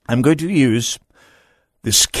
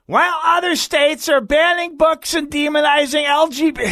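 high, breathy-sounding mock politician voice; Peggy Flanagan impression. Host mocks the lieutenant governor in a high put-on voice. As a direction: lift it way up into a mocking impression of the governor. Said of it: "that's a terrible impression".

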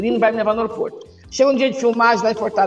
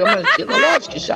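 laughing. Real, not acted. Laughing exaggerated retort.

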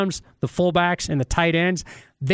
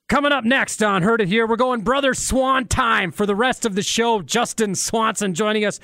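upbeat announcer promo voice; radio promo read; 5 semitones higher than usual. Acted. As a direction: hype the show like a radio promo.

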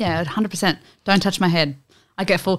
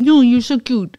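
higher cooing auntie voice; gushing auntie; 5 semitones higher, 5 dB louder, slower than usual. Retold or imitated. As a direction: imitate an auntie fussing over a cute child. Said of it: "like your auntie grabbing up and going".

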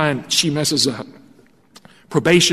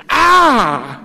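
a sharp, high yelp of pain; pained cry; 8 semitones higher, 5 dB louder than usual. Retold or imitated. Pastor retelling the cat attack, sharp pained yelp. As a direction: cry out as the claws slice your hand. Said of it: "wound, and pain set in".

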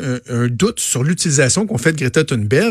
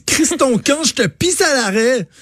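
much higher, mocking Plateau Mont-Royal accent; caricatured Montreal Plateau accent, higher; Plateau guy accent; 11 semitones higher than usual. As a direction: shoo them off in an exaggerated Plateau accent. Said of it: "Il avait dit".

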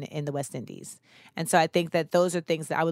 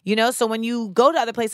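usual speaking voice

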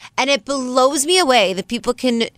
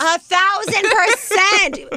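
high, slow and drawn out; emphatic high drawl; 9 semitones higher, much slower than usual. Real, not acted. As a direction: stretch it way up high.

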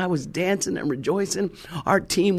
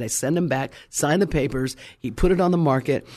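usual speaking voice

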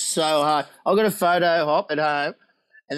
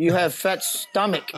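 slow, halting imitation of the director; Japanese commercial director; slower than usual. Director lists the actor's faults, slow deliberate imitation. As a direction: spell out each criticism slowly and deliberately. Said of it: "The bloke, he said".

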